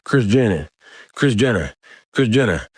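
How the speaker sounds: put-on high, falling country-accent Junior, said like "Jenner"; imitated country accent. Teller mimics the dad naming his son, country accent. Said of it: "he has, like, such an accent".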